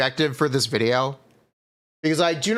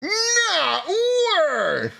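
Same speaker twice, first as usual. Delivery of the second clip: whiny crying call. Acted. Recording voice clip takes.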